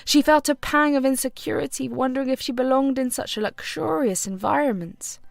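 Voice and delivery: usual speaking voice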